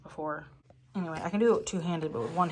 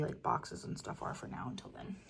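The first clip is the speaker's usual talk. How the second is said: whispered aside; 2 semitones lower, 9 dB quieter than usual. Real, not acted. Trailing off quietly.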